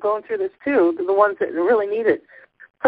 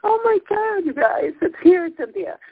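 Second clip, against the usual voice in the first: high, tearful joy; overjoyed, near tears; 12 semitones higher than usual. Real, not acted. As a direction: voice lifted high, on the edge of crying. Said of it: "I'm gonna cry".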